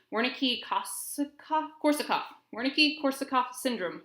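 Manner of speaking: halting stumble over a hard name; tongue-tied. Real, not acted. Presenter recalling a syndrome from past videos, real tongue-tied stumbling. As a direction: trip on the term, then retry it. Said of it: "Sorry, I'm tongue-tied".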